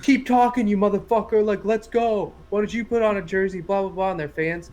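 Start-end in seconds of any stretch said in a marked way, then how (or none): 0.0-3.6 raised, higher-pitched trash talk; worked-up retold taunting; mock screaming taunt. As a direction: imitate a team screaming back at a coach. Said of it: "screaming back at him".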